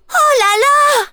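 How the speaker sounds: high, loud cry of dismay. Acted. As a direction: wail at the disaster. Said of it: "dit-elle catastrophée". (Oh là là catastrophé, aigu et fort)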